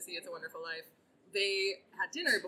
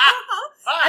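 laughing uh-huh; 10 semitones higher, 17 dB louder than usual. Retold or imitated. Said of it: "And I go".

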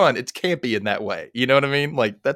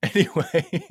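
laughing; 9 semitones higher, 5 dB quieter, slower than usual. Real, not acted. Giggling after calling herself mean.

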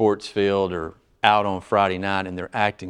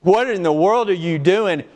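raised, indignant "how dare you" retort; offended indignation; 8 semitones higher, 4 dB louder than usual. Pretend. Churchgoer snapping back at a challenge, offended and raised. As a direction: bristle as someone insulted at being questioned. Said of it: "One gets offended because".

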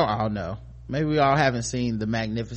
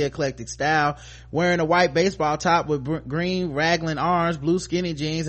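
higher, brisk-sounding news read; article read-aloud; 5 semitones higher, 3 dB louder than usual. Acted. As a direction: read the celebrity story out to listeners.

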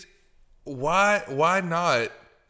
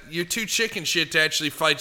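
ordinary delivery

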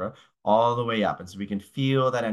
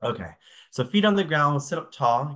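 usual speaking voice

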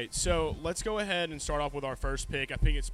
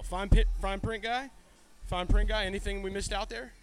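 host callout; 5 semitones higher, 4 dB louder than usual. Acted. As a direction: summon the fine print guy. Calling the disclaimer guy.

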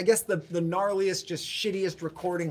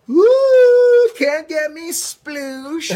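taunting dunk tank guy; 9 semitones higher, 11 dB louder, much slower than usual. Pretend. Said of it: "I'm so".

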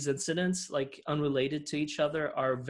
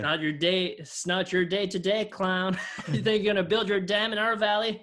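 higher put-on backwoods voice; mock hillbilly menace; 5 semitones higher than usual. Podcaster as banjo people threatening clown, hammed-up taunt.

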